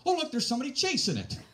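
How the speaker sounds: put-on high, loud excited little girl; excited child. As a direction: squeal it like a five-year-old spotting a boat. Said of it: "she's going".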